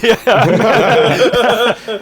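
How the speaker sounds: yeah said through high laughter; can't stop laughing mid-word. Real, not acted. GM breaking up at the player's joke, laughing.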